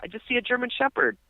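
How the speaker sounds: high-pitched imitation of a baffled friend; mock panic, voice jumping way up; squeaky retold bewilderment; frantic. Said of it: "he seems very, like, frantic".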